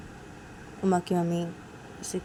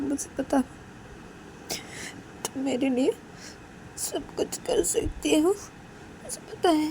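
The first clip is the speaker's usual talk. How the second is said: tearful mother; 6 semitones higher, 6 dB quieter, slower than usual. Acted. Mother answers through tears.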